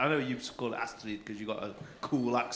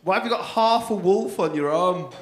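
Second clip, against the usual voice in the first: usual speaking voice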